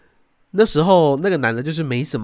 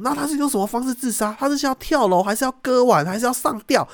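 high, urgent questions, much higher; imitating the sister; 11 semitones higher than usual. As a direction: grill her like the worried sister. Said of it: "然后我姐说". (模仿姐姐尖声追问)

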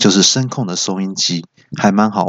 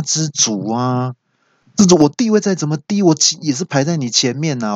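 higher, indignant mock tirade; worked-up scolding; 6 semitones higher than usual. Head of household scolds ignoring radio, mock angry. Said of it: "很生气， 很大声的时候".

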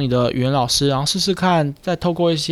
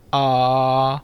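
low resonance demo. Shown as a demonstration. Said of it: "每一个声音都可以啊".

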